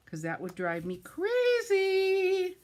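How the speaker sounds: mock exasperation. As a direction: ham up being driven crazy. Joking about uneven layers.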